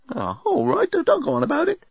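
high, put-upon sulk; sulky robot. A narrator's reading. Bellbot to scolding passenger, sulky. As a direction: give in grudgingly in a high voice. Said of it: "went all sulky".

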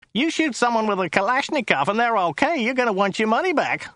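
high-pitched American indignation; incredulous shout. A narrator's reading. Driver scoffing at question about a shooting, high indignant shout. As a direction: rant it high, brash driver. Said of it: "shouted Joe".

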